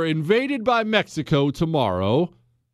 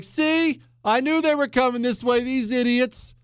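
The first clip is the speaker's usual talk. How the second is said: much higher mock gloating voice; smug Frenchman; 9 semitones higher than usual. Host imitating French commanders fooled by the feint, gloating. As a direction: gloat like you saw it coming. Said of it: "the French would be like".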